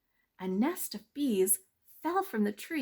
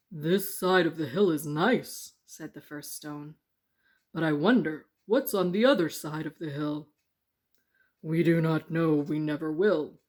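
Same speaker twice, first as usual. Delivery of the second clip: lightly voiced stones, a touch lower and loud; stone voices; 3 semitones lower, 7 dB louder than usual. Stones wondering about the hill, low and loud.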